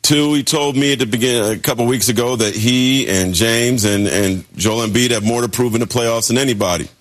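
ordinary delivery

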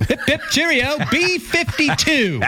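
high, hammed-up British bingo caller; mock British voice. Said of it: "I can't do the British voice".

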